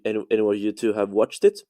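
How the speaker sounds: normal talking voice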